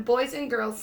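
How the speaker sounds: ordinary delivery, nothing marked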